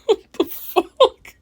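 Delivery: high, slow laughing outburst. Real, not acted. Reacting to the TV man with laughter. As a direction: break up laughing.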